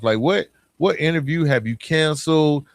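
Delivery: normal talking voice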